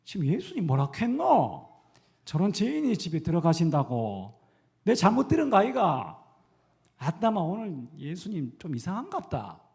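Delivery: loud, high Gyeongsang-dialect crowd, scandalized. Acted. Preacher voicing shocked crowd in Gyeongsang dialect, loud and high. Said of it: "많은 사람들이 수군거립니다".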